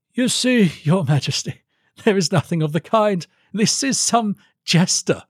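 high, dismissive courtier; high-pitched baronial disdain; scornful baron. Acted. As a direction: write him off to the king in a high voice. Baron mocks Felix to the king.

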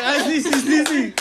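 high, loud imitation of someone about to sneeze; mock sneeze build-up. Teasing Brian about sneezing, mock sneeze build-up. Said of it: "Brian's like".

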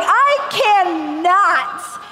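mock horrified refusal; scandalised preacher's daughter; panicked. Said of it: "So I was like".

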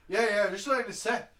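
half-hearted Irish barman impression; lightly voiced Irish barman, brushing him off; poor Irish accent. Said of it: "that's a poor fucking accent".

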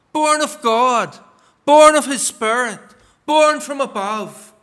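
each "born" hit harder at the start of its phrase; repeated "born" pressed firmly; stress on born. Shown as a demonstration. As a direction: lean on "born" every time, "Born of God, born of his Spirit". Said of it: "I emphasize the word born".

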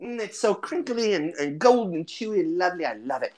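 put-on rapturous old lady voice, pitch sliding down; gushing hobbit grandmother. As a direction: relish it like a granny savoring a treat.

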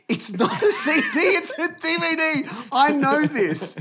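high, loud, slow insistence; exasperated shout. Retold or imitated. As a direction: yell it in disbelief at dad. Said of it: "I'm like".

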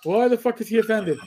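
ordinary delivery

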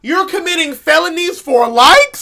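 loud, high disbelief; incredulous shout. Real, not acted. Disbelieving outburst.

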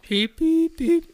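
high beep beep beep; squeaky machine beeps; imitated beeping. Retelling the dentist's gun beeping at him, high beeps. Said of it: "I'm like".

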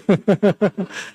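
amused chuckle in a string of short bursts; laugh. Real, not acted. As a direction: chuckle at your own anecdote. Laughs at his own anecdote. (tertawa geli)

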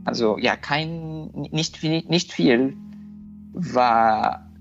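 ordinary talk

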